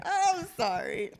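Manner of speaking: laughing apology. Real, not acted.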